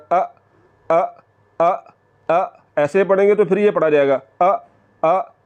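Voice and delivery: अ with a sharp glottal jolt. Shown as a demonstration. Teacher demonstrating the wrong jolted hamza. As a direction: hit अ with a catch in the throat. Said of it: "झटका नहीं लगाना".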